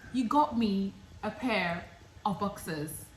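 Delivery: loud, high, exasperated ranting; boyfriend's rant. Retold or imitated. Boyfriend complaining she made such a fuss, loud and exasperated. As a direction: let the frustration pour out.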